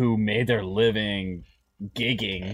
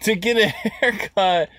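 laughing; 8 semitones higher than usual. Real, not acted. Laughs at own drive.

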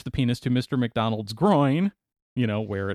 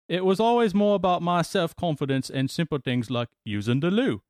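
put-on British accent; 4 semitones higher than usual. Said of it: "You should stop doing that accent".

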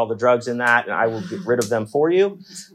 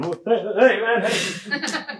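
awkward greeting; 8 semitones higher, much slower than usual. Retold or imitated. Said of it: "this fucking".

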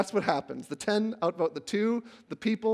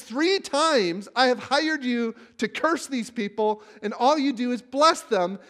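frustrated king; 6 semitones higher, 6 dB louder than usual. Retold or imitated. And Balak says.

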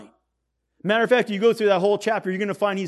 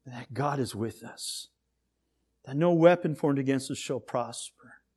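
lowered, measured praying voice; closing prayer; 4 semitones lower than usual. Real, not acted. Pastor prays for his people after the message, lowered prayerful voice. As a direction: bring it down and pray for the people.

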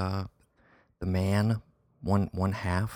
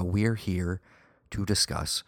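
normal talking voice

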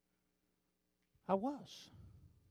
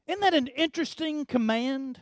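ordinary talk, nothing marked

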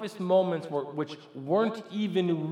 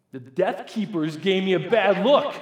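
loud, high indignant complaint; comically outraged tattling; mad outburst; 5 semitones higher, 10 dB louder than usual. Retold or imitated. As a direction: blurt it out, loudly aggrieved. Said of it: "I'm literally mad".

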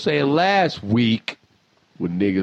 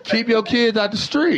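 laughing quote; 11 semitones higher than usual. Retold or imitated.

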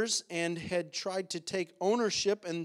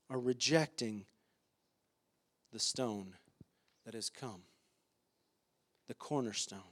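solemn hush; 7 semitones lower, 8 dB quieter than usual. Acted. Solemn point of the sermon.

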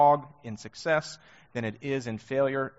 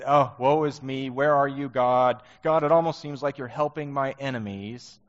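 whining David; 3 semitones higher, 6 dB louder than usual. Retold or imitated. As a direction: whine like a self-pitying king. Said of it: "He's just whining".

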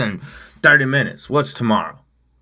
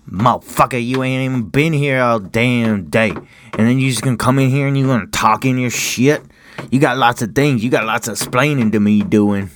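scolding dog, slightly raised and loud; sassy voiced dog; 5 semitones higher, 6 dB louder than usual. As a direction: act out the dog telling off its owner. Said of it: "She's like".

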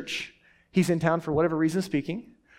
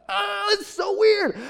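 a high, drawn-out mock shout; high squeaky exclaim; slower than usual. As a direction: squeal it out in disbelief. Retelling own awkward reaction.

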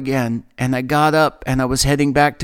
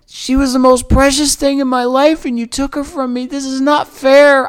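a desperate, raised lament; anguished crying out; 10 semitones higher, 4 dB louder than usual. Retold or imitated. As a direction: beg God through tears to give her back. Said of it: "And I was crying out".

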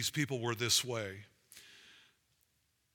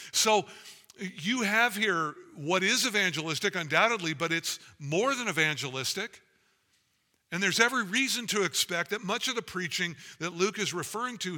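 louder, climbing, fervent sermon delivery; full-force preaching; 5 semitones higher, 5 dB louder than usual. Acted. Pastor urging the church that gospel opens wallets, full-force preaching. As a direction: preach it out with rising fire.

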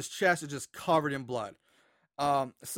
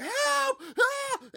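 much higher, desperate shouted cry; frantic yell for help. Retold or imitated. Retelling a man screaming for help on a party train, frantic. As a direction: yell for help as if no one hears you. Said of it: "he's going crazy".

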